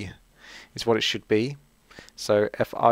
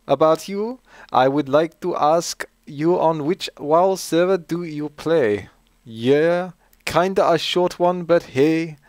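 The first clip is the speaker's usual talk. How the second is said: slow, higher-pitched mock German accent; German-style accent; 5 semitones higher, slower than usual. Host reading a patron's question to himself, mock German accent. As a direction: put on a drawn-out German English accent. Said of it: "read it in German style".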